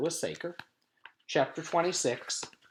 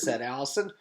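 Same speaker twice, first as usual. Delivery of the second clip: usual speaking voice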